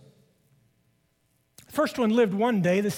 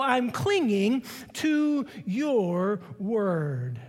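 very slow, drawn out; much slower than usual. Acted. Climax of the sermon passage.

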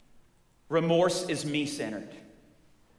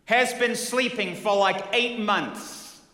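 louder, higher "Has been sleeping" flung back; hot-tempered husband insisting "Has"; "eight months" dragged out slowly in outrage; angry husband; 6 semitones higher, 5 dB louder, slower than usual. Retold or imitated. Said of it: "hot to trot".

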